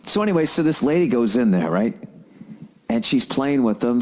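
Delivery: normal talking voice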